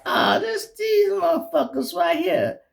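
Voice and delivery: high, loud incredulous cry; high-pitched retold disbelief; disbelieving exclaim. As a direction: act out the shock of recognition. Recognizing the undercover cops.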